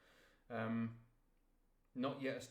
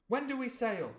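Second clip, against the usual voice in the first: put-on higher, louder eager boy's question; eager cry; 9 semitones higher, 11 dB louder than usual. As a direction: burst out with a boy's impatience. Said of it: "cried I".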